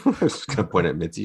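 ordinary talk

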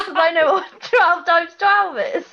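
laughing through the words. Real, not acted. Laughing at her own limited party trick. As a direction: giggle as you admit it.

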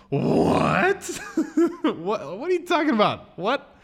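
high, laughing, drawn-out shock; stunned disbelief. Retold or imitated. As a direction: squawk it through incredulous laughter. Retold shock at fire news.

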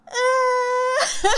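loud, high mock wail; imitated child's wail. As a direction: wail like a toddler who bumped himself. Imitates the child's cry after falling.

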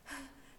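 sharp high gasp in pain; a woman gasping in labor pains; desperate gasp. A narrator's reading. Dying woman gasps before crying for help. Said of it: "disrupted by a woman's cries".

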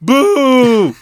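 very loud, high drawn-out boo; playful booing at full volume; voice-cracking boo. Pretend. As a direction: heckle a bad joke, voice cracking. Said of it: "You got that voice crack in there".

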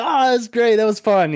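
imitated breezy cheer; mock-cheerful reaction. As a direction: toss it out high and gleeful, imitating him. Acting out driver's post-race reaction.

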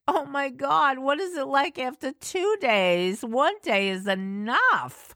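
high, horrified musing; dreading the stew; mock horror.

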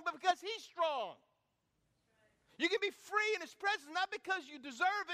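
high, loud, driving altar call; full-force Easter call, rising loud; preached shout. Acted. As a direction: shout them out of their tombs.

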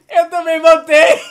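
loud, high, drawn-out exclamation; grito empolgado. Real, not acted. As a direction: shout it in amazed excitement. Espanto pela resposta igual. (grito de empolgação)

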